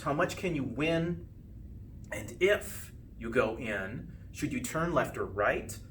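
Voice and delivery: ordinary delivery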